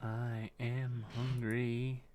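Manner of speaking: slow drawn-out hungry moan; slow whiny hungry. Real, not acted. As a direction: stretch it out, slow and soft.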